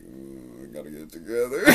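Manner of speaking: low, dazed mumbling to himself; a half-asleep stoner telling himself to focus; stoned mumble. Retold or imitated. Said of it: "I'm sitting there just like".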